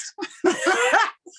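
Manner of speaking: brief laugh. Real, not acted. Laughing at Pinterest as teacher.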